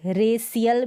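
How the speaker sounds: "रेसियल" with a plain s mid-word; wrong s pronunciation. Shown as a demonstration. Teacher rejects another misreading of racial, wrong s pronunciation. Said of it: "भी नहीं पढ़ेंगे".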